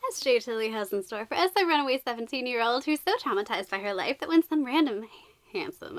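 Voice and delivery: ordinary talk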